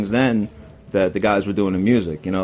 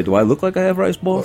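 mock indignant retort, pitched higher; a lightly hammed-up wisecrack to the customer; retelling his snappy pizzeria comeback, half-mocking; sarcastic comeback; 10 semitones higher than usual. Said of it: "I'd say".

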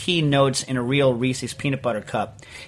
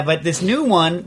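usual speaking voice

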